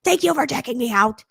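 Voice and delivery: high, loud, gushing thanks; over-the-top energy. Shown as a demonstration. Coach mocking herself thanking grocery store staff, over-the-top energy. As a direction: gush like a manic video host. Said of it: "I don't walk around like this".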